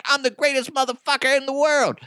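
high-pitched mock boasting. Rapper demanding his face on the bill, mock bragging. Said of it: "He'd be like".

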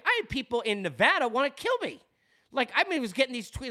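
usual speaking voice

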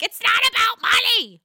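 high, loud mock outburst; mock Margaret. Margaret snapping at Jennifer about Bill, high loud mock outburst. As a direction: blurt it out indignant. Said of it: "Margaret's like".

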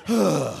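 heavy sigh on sitting; long breathy sigh; demonstrated sigh. Shown as a demonstration. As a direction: exhale audibly as you settle down. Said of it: "I didn't realize I sighed so much".

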